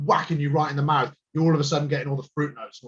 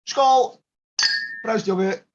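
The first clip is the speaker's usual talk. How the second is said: toasting shout; 7 semitones higher, slower than usual. Shown as a demonstration. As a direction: shout the cheers out. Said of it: "You can just go".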